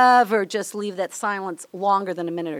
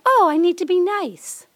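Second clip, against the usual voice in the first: high-pitched, sweetly nice put-on voice; mock niceness; 6 semitones higher than usual. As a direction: act out being oh-so-nice, pitched up. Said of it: "seems so soft".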